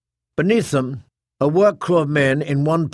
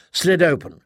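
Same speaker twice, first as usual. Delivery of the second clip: ordinary delivery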